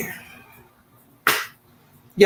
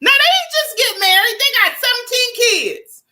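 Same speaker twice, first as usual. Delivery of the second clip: incredulous exclaim. Real, not acted. Exaggerating for comic effect.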